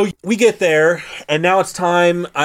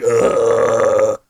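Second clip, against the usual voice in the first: long, low mock groan; queasy groan; 6 semitones lower than usual. Geralt right after being teleported, queasy mock groan. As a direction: groan low as if sick from the trip.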